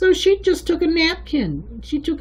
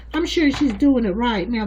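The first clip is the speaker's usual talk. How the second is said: ordinary talk, nothing marked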